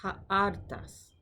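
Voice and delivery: slow, careful Greek model word Ha'artas; Greek pronunciation. Shown as a demonstration. Teacher modelling Greek vocabulary, careful pronunciation. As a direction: say Ha'artas syllable by syllable, stress on ar. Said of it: "Now you".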